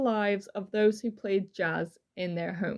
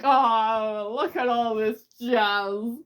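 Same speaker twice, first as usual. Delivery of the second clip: slow, louder, relishing drawl as the killer; mock killer; 5 dB louder, much slower than usual. Killer admiring all the jazz on the streets, slow smug. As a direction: savour it like a smug stroller.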